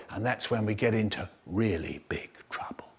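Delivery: low, hushed storyteller's aside; confiding whisper. A narrator's reading. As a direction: whisper the warning to the listeners. Narrator confides to the listeners.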